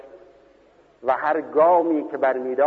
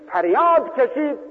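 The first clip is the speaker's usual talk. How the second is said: raised preaching voice; 10 semitones higher, 3 dB louder than usual. Acted. Preacher rising to climax.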